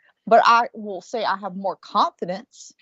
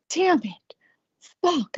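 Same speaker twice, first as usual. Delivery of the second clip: put-on higher, frustrated teen cursing; frustrated daughter voice; 8 semitones higher, much slower than usual. Daughter swearing to herself after seeing her crush. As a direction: play the annoyed daughter swearing under breath.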